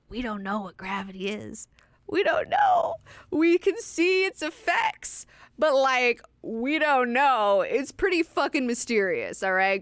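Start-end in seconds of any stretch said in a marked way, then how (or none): 2.1-9.8 much higher and louder, drawn out; loud, slow, wide-eyed insistence; emphatic exclaim. Real, not acted. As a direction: hammer home the mystery. Emphatic rant about gravity.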